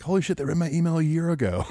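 high-pitched imitation of a stunned listener; mock amazement. Host imagining the emailer hearing this later, mock amazement. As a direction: pitch it up in playful disbelief. Acts out listener's surprised reaction.

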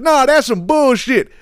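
high, drawn-out mock protest; mimicked objection. Comedian retells the passenger rejecting the woman's claim, high drawn-out mock objection. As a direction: imitate a passenger refusing to buy it. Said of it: "He's like".

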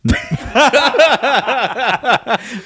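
high, loud burst of laughter; a sudden high cackle. Real, not acted. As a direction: crack up at the answer. Explodes laughing at the answer.

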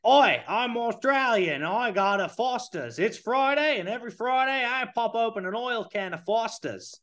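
high, hammed-up Aussie accent; exaggerated Australian twang, pitched high; broad Australian accent. Shown as a demonstration. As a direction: show off a cartoonish Australian accent. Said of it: "rate my Australian accent".

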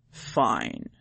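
low, soft reluctant big cat; grudging tiger. A narrator's reading. Tiger reluctantly agrees, low and soft. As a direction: give in grudgingly.

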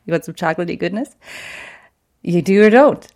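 goofy playful voice. Retold or imitated. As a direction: tease her with a goofball voice. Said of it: "don't say it like that".

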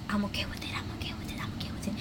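barely audible, repeated whisper to oneself; whispered self-reassurance. Retold or imitated. Worst in the class reassuring herself, whispering. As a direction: whisper it like a private mantra.

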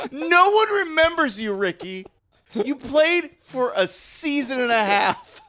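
high, loud teasing jeer; a friend jeering at the fake ad man; mock heckling. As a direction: heckle the washed-up spokesman. Mocking the fake spokesman.

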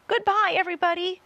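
high, loud, rushed goodbye; gasped farewell. A narrator's reading. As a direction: cry a hurried last farewell in fear. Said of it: "gasped James".

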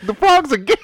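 high, loud mocking rant; mock a conspiracy ranter.

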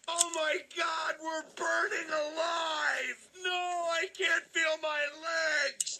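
high, slow crying shout; burning scream. Retold or imitated. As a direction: wail in agony, drawn out. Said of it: "your family's screaming".

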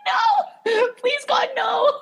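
very high hammed-up wail through laughter; mock horrified plea. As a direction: plead in squeaky mock despair, laughing. Retelling her horrified inner plea.

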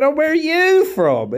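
high, loud, swooping question; eager crowd-work question. Retold or imitated. Comic asking an audience member, high loud questioning. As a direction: pitch it up and out to the crowd.

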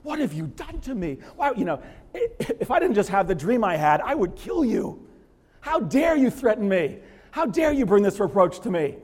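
high, loud, wild-eyed outrage with a laugh; a frantic king barely holding back. Acted. Abimelech rages at Abraham, wild-eyed and high. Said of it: "He's, he's wild-eyed".

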